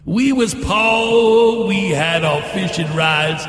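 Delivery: half-sung mock New Orleans accent, drawn out at first. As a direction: parody a singer's put-on New Orleans accent. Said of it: "he's gotta talk with that New Orleans accent".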